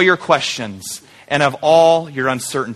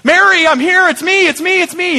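much higher, loud, bouncing with excitement; overexcited risen Jesus begging Mary to notice him; mock giddy Jesus; 12 semitones higher, 4 dB louder than usual. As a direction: jump up and down shouting it's me. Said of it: "going, doing jumping jacks".

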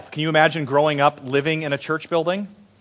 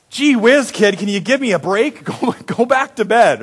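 higher, louder exasperated grumble; frustrated; 6 semitones higher, 4 dB louder than usual. Acted. Woken older guy to the kid, frustrated. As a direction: play up an older man's exasperation. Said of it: "I'm getting a little frustrated too".

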